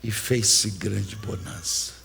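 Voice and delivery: narração baixa, grave e lenta; calmaria. Acted. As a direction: deixe a voz assentar como a bonança. Narra a calmaria após a tempestade. (narração serena e pausada)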